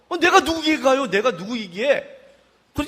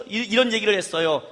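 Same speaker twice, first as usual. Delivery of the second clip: usual speaking voice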